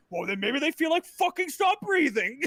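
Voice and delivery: put-on old man's indignant complaining; cranky old man. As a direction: grouse like a fed-up ninety-year-old. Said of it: "why are you so mad".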